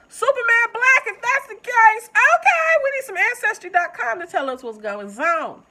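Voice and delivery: very loud, high-pitched hollering; high comic rant at full volume; excited outburst. Acted. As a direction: holler it in worked-up disbelief, easing down at the end. Mock outburst over a family reveal.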